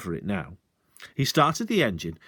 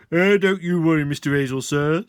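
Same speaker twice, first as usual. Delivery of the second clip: louder, hearty reassurance; cried out; 5 semitones higher, 6 dB louder than usual. Acted. Sergeant loudly reassuring the landowner. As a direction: call out with false helpfulness. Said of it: "cried out".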